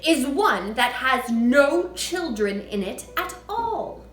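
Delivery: loud, booming headmistress tirade; Trunchbull. A narrator's reading. Headmistress ranting to the class about children. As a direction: bellow it like a tyrant headmistress. Trunchbull lecturing Miss Honey.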